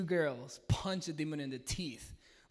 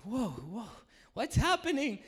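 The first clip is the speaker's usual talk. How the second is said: startled whoa; 6 semitones higher, 4 dB louder, slower than usual. Retold or imitated. Panic during deliverance.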